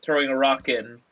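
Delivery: ordinary delivery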